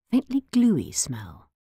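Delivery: plain reading voice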